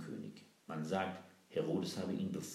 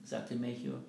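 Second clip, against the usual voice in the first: usual speaking voice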